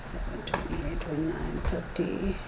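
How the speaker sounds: very soft, barely voiced count; counting to oneself. Real, not acted. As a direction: count the cards under your breath. Counting cards to herself.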